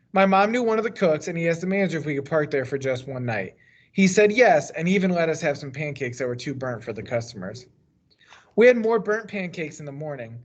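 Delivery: usual speaking voice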